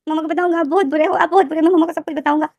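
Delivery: put-on weepy childish complaint, rising in pitch; crying child. A narrator's reading. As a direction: whimper it out like a hurt little kid. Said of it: "लेनदेन यही बोल रहा था और रोए जा रहा था".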